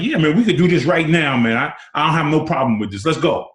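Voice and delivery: daring him to go now; fired-up challenge. Retold or imitated. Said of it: "and I'm like".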